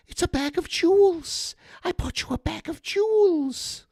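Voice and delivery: goofy high-pitched movie voice; squeaky, dopey impression; Tom Green. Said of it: "Tom Green buys his girlfriend".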